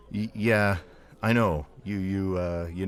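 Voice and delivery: nervous stutter, words tripping; stammering. Retold or imitated. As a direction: stammer it out, starstruck. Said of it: "And I stammered".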